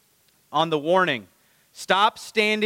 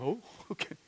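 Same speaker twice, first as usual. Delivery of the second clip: sheepish laugh; 3 semitones higher, 14 dB quieter, much faster than usual. Retold or imitated. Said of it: "And what did they do?".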